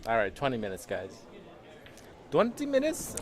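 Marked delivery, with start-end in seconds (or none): 2.3-3.1 much higher, startled question; surprised. Real, not acted. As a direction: blurt it out in disbelief. Shocked at the twenty-minute deadline.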